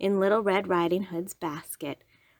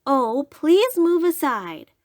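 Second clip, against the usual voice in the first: put-on little girl voice; 7 semitones higher, 5 dB louder, slower than usual.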